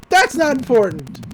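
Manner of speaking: mock tearful outburst. Angry pool boss caught crying, mock tearful protest. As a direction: imitate someone loudly denying their tears. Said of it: "He is crying".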